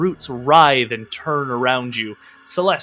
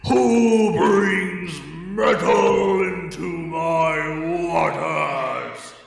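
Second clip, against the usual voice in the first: slow, huge booming spirit voice; booming tree voice; 4 semitones higher, much slower than usual. Acted. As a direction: boom it out like an ancient tree. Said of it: "a thunderous voice booms out".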